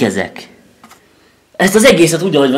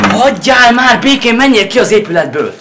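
loud, higher, slowed furious yell; angry yelling; 8 semitones higher, 5 dB louder than usual. Real, not acted. Son yelling at his father to leave him alone, furious. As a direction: shout him off in real anger. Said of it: "Te mit üvöltözöl egyébként?".

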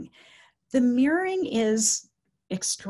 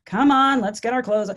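loud, urgent "come on"; brisk coaxing; 7 dB louder than usual. Retold or imitated. Staff hustling an undressing resident back, briskly coaxing. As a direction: chivvy someone along, acted out.

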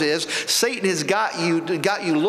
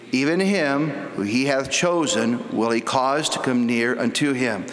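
lower, measured King James reading voice; scripture reading; 5 semitones lower than usual. Acted. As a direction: read the verses out like holy writ. Preacher reads scripture aloud.

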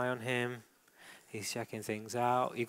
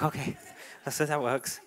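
laughing through the dog's reply; amused dog's voice. Acted. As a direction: voice the dog with a chuckle. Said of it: "It's like".

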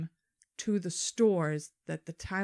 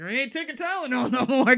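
higher, louder, lightly voiced mother; scared mother; 6 semitones higher, 8 dB louder than usual. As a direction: declare it like a spooked mom. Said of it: "my mother ... being like".

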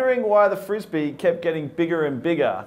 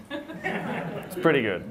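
"It's pretty good" slowed right down through a laugh; 2 semitones lower, 6 dB quieter, much slower than usual. Shown as a demonstration. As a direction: drawl it out while laughing.

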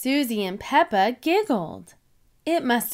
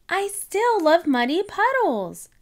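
put-on high, loud happy child; excited cry; 8 semitones higher, 3 dB louder than usual. A narrator's reading. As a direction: cry out with delight. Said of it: "cried Peppa".

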